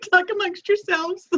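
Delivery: emotional, trying to recover; verklempt. Real, not acted. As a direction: make a joke through real tears. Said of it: "A little verklempt".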